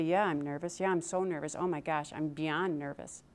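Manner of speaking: a touch of jittery worry; nervous admission. Retold or imitated. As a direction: confess the nerves quietly. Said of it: "And you say".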